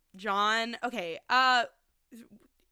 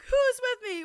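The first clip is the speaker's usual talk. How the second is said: high, loud call to follow; rally the men with a shout; 11 semitones higher, 7 dB louder than usual. Retold or imitated. Jon calling his men to follow, loud rally.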